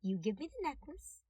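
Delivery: a cute threat, almost whispered at first; playful dangerous deal; sweetly threatening detective. A narrator's reading. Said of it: "He suggested".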